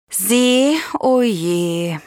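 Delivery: mildly dejected, drawn out; understated sorrow; sad. A narrator's reading. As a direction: sigh it with disappointment. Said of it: "sagt Shira traurig".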